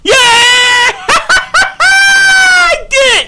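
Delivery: laughing, whooping "I did it"; triumphant shout. Real, not acted. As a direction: cheer it out loud, laughing. Triumph after fixing audio.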